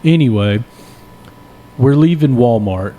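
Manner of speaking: usual speaking voice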